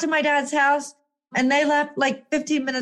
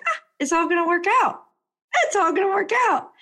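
breezy optimism; 7 semitones higher, faster than usual. Retold or imitated. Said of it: "you're just like".

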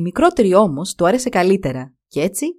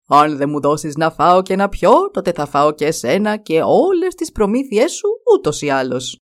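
lightly voiced menacing dragon; threatening dragon; 3 semitones lower than usual. A narrator's reading. Dragon threatens to eat the hero.

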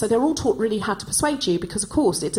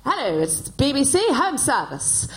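put-on higher, slow, plummy old BBC radio announcer; BBC announcer English; 4 semitones higher than usual. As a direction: put on a vintage British broadcasting accent. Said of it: "learn so that you could say".

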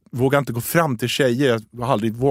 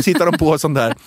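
ordinary talk, nothing marked

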